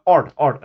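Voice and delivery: art with retroflex rd, like "ard"; retroflex consonants. Shown as a demonstration. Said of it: "r is a d, d sound".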